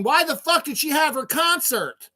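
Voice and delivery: high-pitched imitation of outraged relatives; mock grieving family. Grieving families blaming the singer, mock outraged quote. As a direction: mimic the family's angry question, high. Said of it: "They would be saying".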